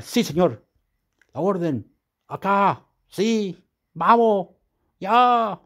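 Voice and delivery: mock soldier reporting for duty; acted military replies. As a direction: answer an imagined commander, word by word. Acting out obedient military replies.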